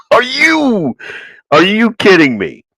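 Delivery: high-pitched, disbelieving "are you"; real exasperated disbelief; incredulous. Real, not acted. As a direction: start high in disbelief, then come down. Host reacts to quiet retirements.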